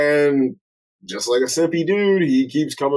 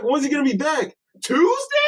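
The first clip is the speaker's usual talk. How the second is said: incredulous retold question; 11 semitones higher than usual. Retelling Dante's reaction to the boss leaving, incredulous. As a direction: pitch leaping very high on the last word.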